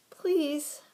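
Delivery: high, urgent plea; pleading. A narrator's reading. Mouse begging the gopher for help, pleading. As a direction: beg for rescue. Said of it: "pleaded Ralph".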